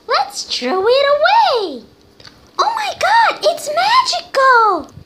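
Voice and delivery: very high, cartoonish squeak; squeaky high voice. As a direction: play a tiny squeaky character.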